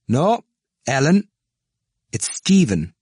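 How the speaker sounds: put-on high, loud bright old woman; cheery. A narrator's reading. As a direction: call it out cheerily. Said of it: "answered cheerily".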